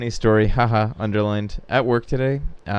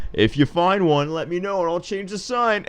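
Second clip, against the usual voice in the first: high, dry fake-helpful reply; dry deadpan; 7 semitones higher than usual. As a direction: answer with dry mock helpfulness. His dry reply to tourists.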